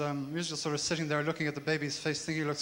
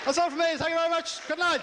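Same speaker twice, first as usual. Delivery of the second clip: much higher, louder goodbye over the applause; loud sign-off; 13 semitones higher, 5 dB louder than usual. Real, not acted. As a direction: shout thanks to the crowd as you leave. Comedian signs off to crowd.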